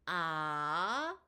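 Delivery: "아" held long, starting low and rising steeply like a question; second-tone rise. Shown as a demonstration. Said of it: "다시요". ("아"를 낮은 음에서 높은 음으로 길게 끌어올리기)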